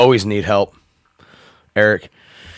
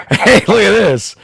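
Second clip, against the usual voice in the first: Harry Carey voice; 9 semitones higher, 4 dB louder than usual. Pretend. Continues impression.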